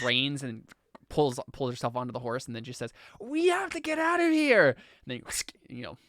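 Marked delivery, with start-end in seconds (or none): 3.2-4.8 high-pitched urgent shout; really loud. Acted. Deafened woman urging companion to flee, really loud. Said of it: "really loudly says".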